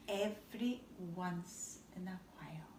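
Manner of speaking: soft breathy whisper. A narrator's reading. Reader finishing Daddy's advice, whispering. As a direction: drop to a whisper.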